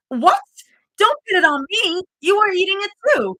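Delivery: indignant protest. Acted. Zoe protests to Rumi who blames her, high and indignant. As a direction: fire it back, outraged at being blamed. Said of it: "Zoe whipped her head toward her".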